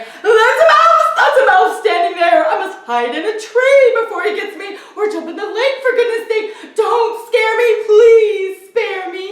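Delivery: high, loud frightened elephant; panicked elephant. A narrator's reading. Elephant panicking at the mouse, high and loud. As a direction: panic and plead to be spared. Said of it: "elephant panics at mouse".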